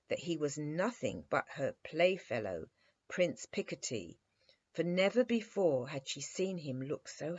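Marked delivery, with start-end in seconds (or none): none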